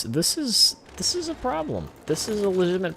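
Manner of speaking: exasperated squeak. Real, not acted. Ranting about YouTube problem.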